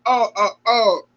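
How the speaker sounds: real tears welling up; about to cry. Real, not acted. Host moved by gymnast's story, near tears. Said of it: "Okay, I'm about to cry".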